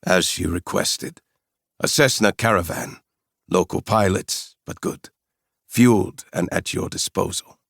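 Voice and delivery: put-on businesslike South African accent; brisk South African report on the plane. Mercenary confirms the plane for Kong, South African accent. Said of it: "With a strong South African accent".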